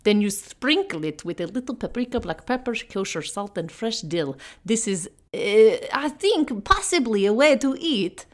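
hammed-up mock Russian accent with dramatic flourishes; bad Russian accent. [name] walks through the Ritz recipe in a bad Russian accent. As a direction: present the recipe like a brooding Poe in broken Russian English. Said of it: "like Edgar Allan Poe with my delivery".

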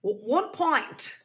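high, slow, hesitant put-on Australian accent; Australian walking into a UK pub, mock Australian accent. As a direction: order timidly in a hammed-up Australian accent. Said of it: "an Australian walks into a U K pub".

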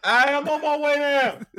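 loud call to the next room; yelling across the room. Real, not acted. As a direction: call out to someone far off. Answering wife from basement.

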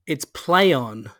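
"play on" said slowly as play-on; slow "play on", rhyming with day; correct pronunciation. Shown as a demonstration. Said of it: "is how you're supposed to pronounce it".

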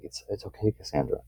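soft hushed reassurance to a scared friend; gently calm her down; soothing comfort. Acted. Comforting frightened Cassandra, soft and reassuring. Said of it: "there also being like".

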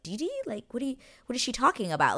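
put-on high, baffled girlish voice; confused Edwina. Edwina baffled at dinner, high confused voice. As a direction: squeak out her confusion. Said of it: "She was just like".

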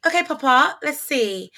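put-on British accent. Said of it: "I'd be like".

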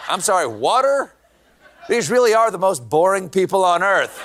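ordinary talk, nothing marked

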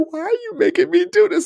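high, whiny, tearful plea; mock near-crying plea. Exhausted client on the ground pleading with his trainer, near tears. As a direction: whimper it like he's about to cry. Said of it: "he's about to start crying".

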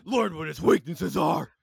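Lego Batman voice. Acted. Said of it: "he's playing the Lego Batman voice".

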